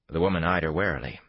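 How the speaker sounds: ordinary delivery, nothing marked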